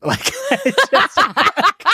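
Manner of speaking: words squeezed out through high giggles; breaking up mid-sentence with real laughter; laughing. Real, not acted. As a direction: laugh while trying to talk. Laughing at the fish scheme.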